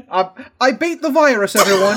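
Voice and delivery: loud, crowing mock-politician voice; gloating Boris Johnson. Podcast host voicing an insufferable survivor, gloating. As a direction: crow it like a triumphant survivor. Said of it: "he'll be like".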